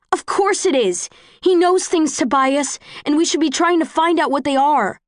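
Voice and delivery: high, loud insistence; angry shout. Acted. Quarrel on the train.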